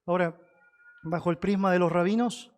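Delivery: normal talking voice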